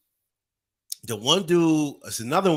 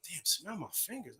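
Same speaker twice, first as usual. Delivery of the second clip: muttered aside; 14 dB quieter than usual. Retold or imitated. Muttered aside in cop car.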